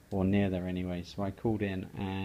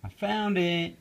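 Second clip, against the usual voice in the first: higher, louder happy call; mild yell; 7 semitones higher, 4 dB louder than usual. Acted. Froggy shouting to mother, mild yell. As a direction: call out the find. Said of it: "yelled Froggy".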